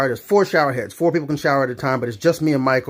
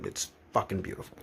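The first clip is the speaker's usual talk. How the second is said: whispered aside; 7 semitones lower, 15 dB quieter than usual. Real, not acted. Aside on drop kicks.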